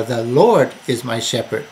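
"Lord" stretched and punched; emphatic "Lord", the rest left unstressed; stress on LORD. Shown as a demonstration. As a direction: lean hard on the word "Lord". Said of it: "emphasize different words to bring out".

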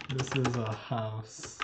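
This is a house, slow and careful; slow translation. Shown as a demonstration. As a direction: spell it out slowly. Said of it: "Это дом".

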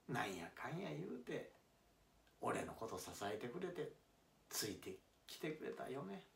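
soft, quiet thanks to his wife; man softly thanking his wife who stood by him; tender apology. Acted. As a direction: murmur it gently, full of regret.

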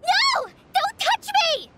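high frightened shriek; panicked cry. Acted. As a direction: recoil and cry out in fear. Collei panics at being touched.

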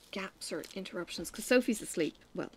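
normal talking voice

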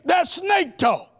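slow, loud, high shout; shouted denunciation. Acted. Preacher condemning false doctrine to the church, loud slow shout. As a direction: shout it down slowly.

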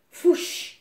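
loud, high, sudden whoosh; whooshing sound effect. A narrator's reading. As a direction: burst out like something swooping overhead. Sound word for something fluttering past.

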